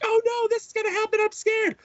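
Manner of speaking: very high squeaky mock-frightened kid voice; imitating scared child. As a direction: put on a little kid's panicky falsetto. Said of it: "he'll be like; I'm scared".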